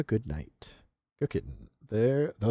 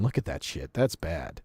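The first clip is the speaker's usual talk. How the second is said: ordinary talk, nothing marked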